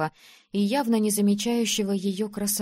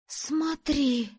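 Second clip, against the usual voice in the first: high-pitched, astonished exclamation; amazed gasp; 7 semitones higher than usual. A narrator's reading. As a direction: gasp the word out in wonder. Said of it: "ахнула она". (ахнув от изумления)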